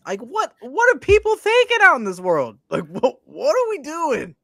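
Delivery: high-pitched incredulous wail; mock exasperation. Co-host appalled at people in this world, high mock exasperation. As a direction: voice shoots way up in disbelief. Mock outrage at coach's behaviour.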